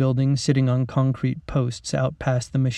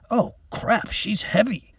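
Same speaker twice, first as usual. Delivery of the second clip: straining to lift; 9 semitones higher, slower than usual. Acted. Lifting a heavy doll.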